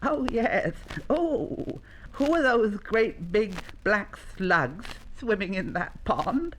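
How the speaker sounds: high, wondering little voice; curious young llama. Acted. Young llama asks keeper about creatures in the pond, wondering. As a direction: marvel in a childlike animal voice.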